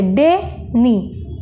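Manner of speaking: डे, नी slowly, with a pause between; split into syllables. Shown as a demonstration. Teacher showing the break, डे, नी in separate beats. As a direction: break clearly after डे, then say नी. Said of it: "बीच में हम एक बार break ले रहे हैं".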